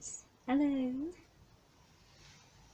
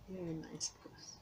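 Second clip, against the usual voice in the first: coaxing whisper; 3 semitones lower, 12 dB quieter than usual. Real, not acted. Whispering to petted cat.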